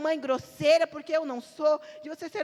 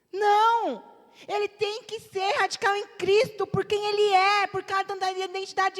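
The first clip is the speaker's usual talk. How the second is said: forceful preaching; 5 semitones higher, 3 dB louder than usual. Real, not acted.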